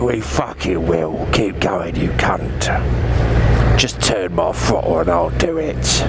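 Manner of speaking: low tough-guy voice egging the rider on; Dave's voice. Retold or imitated. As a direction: growl back like a tough bouncer. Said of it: "And Dave's like".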